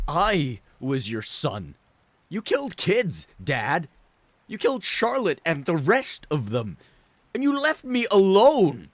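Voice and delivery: pleading, close to tears; tearful breaking voice. Acted. Son accusing his father of abandonment, tearful and high.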